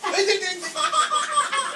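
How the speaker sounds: laughing. Real, not acted. Pet reacts, laughter.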